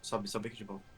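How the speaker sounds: low hushed voice, sneaking; whispering while hiding. Real, not acted. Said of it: "não faz barulho".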